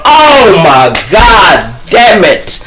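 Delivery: loud, slow, dragged-out "oh my God"; retold bailiff's horrified shout; mock horrified outburst. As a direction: play the bailiff gasping in dread. Bailiff reacts to heroin case.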